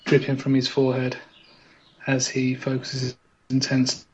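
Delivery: low hushed live commentary; game commentator. Acted. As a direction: talk him up like a TV commentator. Mock game-show commentary.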